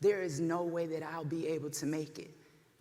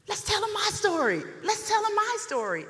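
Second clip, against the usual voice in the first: higher, louder, urging; eager plea; 12 semitones higher, 6 dB louder than usual. Retold or imitated. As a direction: beg to be heard. Pleading with mom, retold.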